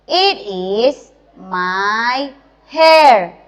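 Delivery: loud, slow model sentence; loud slow vocabulary modelling; slow teacher drill. Acted. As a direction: spell it out loud for children.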